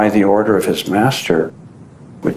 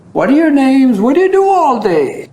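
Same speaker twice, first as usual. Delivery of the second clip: high, louder friendly asking; friendly questions; 13 semitones higher, 3 dB louder than usual. Retold or imitated. Baba befriends the boys under the tree, high and friendly. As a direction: chat kindly with the boys.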